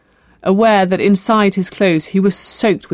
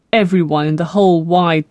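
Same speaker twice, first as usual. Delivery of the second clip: ordinary delivery, nothing marked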